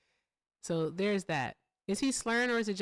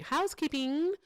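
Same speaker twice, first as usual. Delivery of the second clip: calling out; 8 semitones higher than usual. Real, not acted. Calling out to housekeeper.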